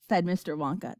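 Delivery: usual speaking voice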